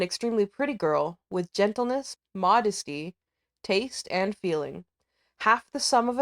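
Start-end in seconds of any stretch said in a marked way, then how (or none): none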